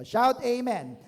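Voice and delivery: loud, high rallying call; rousing shout. Real, not acted. Preacher calling the congregation to respond, rousing shout. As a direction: shout it out to rouse the room.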